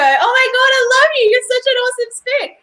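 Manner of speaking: high, loud, gushing mock adoration; gushing affection. Shown as a demonstration. Showing a friend how tone changes a word, gushing affection. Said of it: "But if you go".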